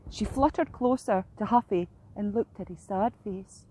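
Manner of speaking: usual speaking voice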